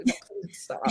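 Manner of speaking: soft whispered please stop. Retold or imitated. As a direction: breathe it out like a quiet plea. Begging God to stop.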